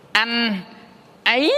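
"Anh" held level, "ấy" sliding sharply upward; rising-tone demo. Shown as a demonstration. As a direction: say "Anh, ấy" apart, lifting the pitch on "ấy". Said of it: "nghĩa là lên ha". ("Anh" ngang, "ấy" vút lên cao)

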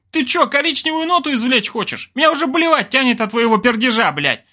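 high, strained exasperated rant; angry bark. A narrator's reading. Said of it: "рявкал он".

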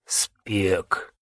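barely audible dying whisper; faint whisper. Acted. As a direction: breathe the word out weakly. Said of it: "чуть слышно прошептал боец".

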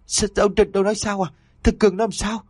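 a mother who has just heard her son is dead; stammering in shock. A narrator's reading. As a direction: blurt it out, too stunned to finish a thought. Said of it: "cố gắng lắp bắp".

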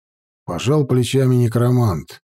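usual speaking voice

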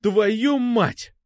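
high-pitched angry outburst; snapping. A narrator's reading. Сергей loses his temper with Алёна, snapping. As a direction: lose control and curse. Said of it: "сорвался я".

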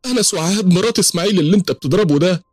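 very high, wailing protest; high-pitched acted old woman crying out; distraught old woman. A narrator's reading. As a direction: plead frantically for him to be let go. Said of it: "ست كبيرة".

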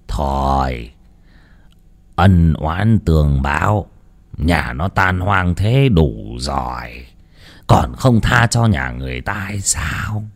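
low, slow whispered plea; grave priest. A narrator's reading. Priest quietly pleads with the ghost, slow and hushed. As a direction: ask the ghost for mercy, hushed.

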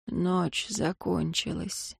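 soft, slow, weak whisper; barely voiced, slow and faint. A narrator's reading. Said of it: "прошептал Рэм".

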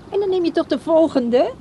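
high, loud eager plea; coaxing a friend to skip his boat, eager and loud. Acted. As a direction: press him to stay, pitch rising with eagerness. Coaxing Frits to stay.

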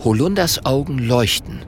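ordinary delivery, nothing marked

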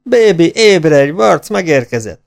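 high, slow, loud calls to wake others; excited calling out. Acted. Man wakes the neighbours, slow high excited shouts. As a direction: drag out each call to rouse the house.

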